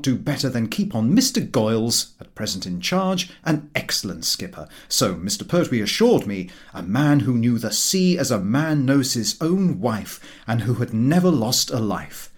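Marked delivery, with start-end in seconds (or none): none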